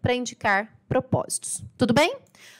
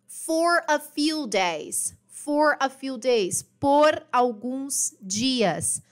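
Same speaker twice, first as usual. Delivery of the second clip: usual speaking voice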